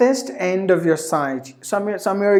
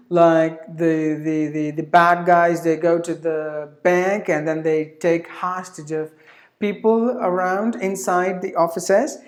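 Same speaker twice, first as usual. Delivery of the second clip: ordinary talk, nothing marked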